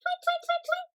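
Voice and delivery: very high, tiny chirping tweets; bird tweets. Pretend. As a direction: peep like a little bird. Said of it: "can you sound like a bird?".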